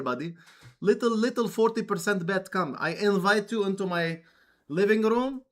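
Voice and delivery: playful Russian poker player sweet-talking his opponent; Russian dude voice. Acted. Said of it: "Russian dude voice".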